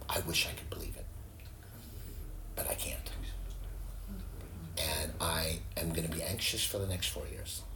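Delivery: barely voiced, soft and resigned; hushed confession. Pastor retelling the anxious woman's answer, whispered. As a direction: whisper her defeated admission. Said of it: "she says".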